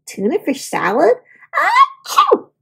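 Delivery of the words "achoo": sneeze. Acted. A sneeze in the story.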